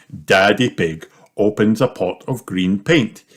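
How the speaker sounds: ordinary delivery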